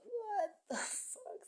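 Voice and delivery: high, slow, drawn-out outcry; shocked what the fuck. Retold or imitated. Retelling his reaction to her greeting, high and slow. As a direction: stretch it out in stunned disbelief. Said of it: "I was like".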